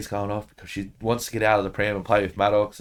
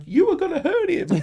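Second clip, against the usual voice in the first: put-on much higher little girl's worried voice; daughter's voice. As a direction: play the scared little daughter. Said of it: "she's like".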